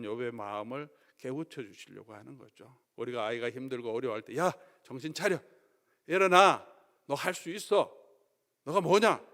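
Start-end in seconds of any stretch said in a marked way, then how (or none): 4.4-9.2 put-on loud, high urging of a child; pushing encouragement. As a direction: shake a struggling kid awake. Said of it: "다그치고 격려하듯이".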